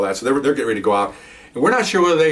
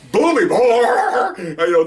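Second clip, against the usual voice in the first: drawn-out, higher word broken by a real laugh; 10 semitones higher, 4 dB louder, much slower than usual. Real, not acted. Teller laughing at the booming dog bark, real laughter. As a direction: laugh right through the word.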